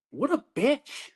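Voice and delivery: drawn-out scornful put-down; psychiatrist's scorn. Retold or imitated. Psychiatrist reacting to commander's remark, scornful. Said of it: "psychiatrist was like".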